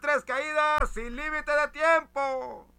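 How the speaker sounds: high, slow, drawn-out ring announcer; lucha libre announcer. Pretend. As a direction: stretch every word like the arena MC. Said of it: "como boxeador".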